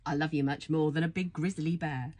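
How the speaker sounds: usual speaking voice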